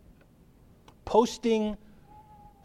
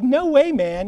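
higher, louder sudden refusal; alarmed friends; 6 semitones higher, 6 dB louder, slower than usual. Retold or imitated. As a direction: blurt it out to stop a friend rushing in. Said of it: "the disciples are like".

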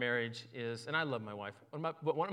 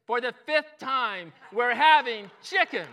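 exasperated loud, high retort; agitated; 9 semitones higher, 10 dB louder than usual. Acted. Wife answers her hard-of-hearing husband the fifth time, agitated and loud. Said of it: "you could tell that she was agitated".

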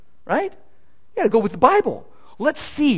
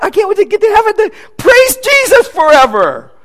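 much higher, loud shout, "praise" the peak; excited shout; 12 semitones higher, 6 dB louder than usual. Shown as a demonstration. Preacher showing the right hope, shouted with joy. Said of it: "how about".

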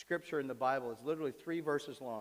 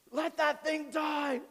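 high, loud, slow mock wail; mock protest; 12 semitones higher, 5 dB louder, much slower than usual. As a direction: cry it out in hammed-up dismay. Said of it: "that's how our response is".

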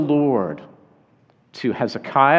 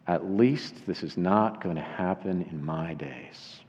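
low, softer aside to oneself; inner thought; 8 semitones lower, 9 dB quieter than usual. Acted. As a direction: think it aloud, low and quiet. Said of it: "he was thinking in his mind".

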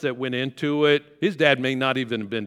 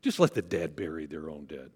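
low, soft and dry sarcasm; mock-sarcastic take on the verse; 9 semitones lower, 5 dB quieter than usual. Shown as a demonstration. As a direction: toss it off sarcastically, dismissive. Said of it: "Did he say it sarcastically?".

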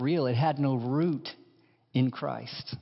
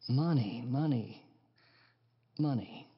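each money softer until nearly gone; money, money, money fading to a hush; fading echo; 3 semitones lower, 6 dB quieter than usual. Acted. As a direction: let it drift away like an echo. Echoing, fading repetition.

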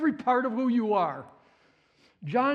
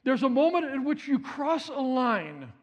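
ordinary talk

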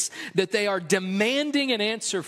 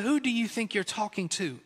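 a touch of a stern mom, softer and half-laughing; mom's scolding question; 4 dB quieter than usual. Retold or imitated. Mother to sassy child, a lightly voiced stern mom warning. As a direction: quietly raise an eyebrow and warn, lightly voiced mother.

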